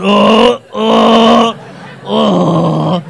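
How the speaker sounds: loud strained groans of "oh" getting up; old man groans. Retold or imitated. The elderly dad kneeling and standing in church, loud strained "oh" groans. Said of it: "one poor eighty-year-old man just going".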